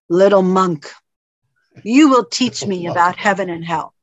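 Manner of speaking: low, commanding warrior voice; booming samurai. Acted. Samurai orders the little monk to teach him, booming. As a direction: boom it out low with authority. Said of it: "speaks in this booming voice".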